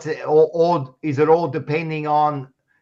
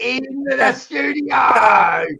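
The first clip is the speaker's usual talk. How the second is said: slow, drawn-out showman announcement, higher and louder; announcer call; 8 semitones higher, 4 dB louder, much slower than usual. Acted. Host hyping a guest's arrival, announcer call. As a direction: bellow it like a ring announcer.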